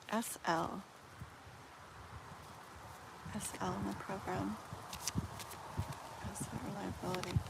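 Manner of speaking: reading the question softly to oneself; hushed murmur. Real, not acted. Murmurs question while shuffling cards.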